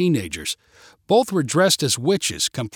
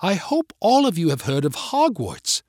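higher, theatrical British welcome; British accent; 4 semitones higher than usual. A narrator's reading. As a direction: address them as a British headmistress. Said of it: "in her British accent".

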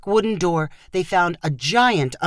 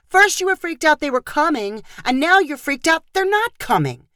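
yelling; 8 semitones higher than usual. A narrator's reading. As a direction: yell it at him, fed up. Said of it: "Chloe yelled at him".

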